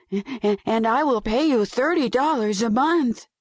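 put-on high, stammering sweet old lady; sweet and gentle. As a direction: coo it like a kind grandmother. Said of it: "in a sweet and gentle voice".

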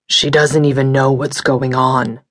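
lightly gruff, low-pitched woman. Acted. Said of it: "deeper and gruffer than the first".

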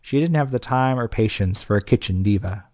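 ordinary delivery, nothing marked

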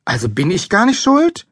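put-on high, rising, child's question; surprised question. A narrator's reading. Boy to angry mother, surprised. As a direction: ask in hopeful surprise.

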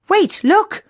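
high, sudden excited call; excited cry. A narrator's reading. Billy spots shapes in the rocks, excited cry. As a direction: cry out at a discovery. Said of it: "cried Billy".

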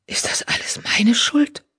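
breath-filled, troubled whisper; anguished self-questioning. A narrator's reading. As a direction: whisper it to yourself in disbelief. Anguished silent question to herself.

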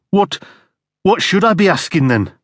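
nervous. Acted. Captive questioning masked captor.

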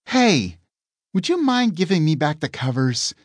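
lightly voiced sweet young woman; sweet female voice. A narrator's reading. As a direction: play Eve asking sweetly. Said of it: "came a sweet-sounding voice".

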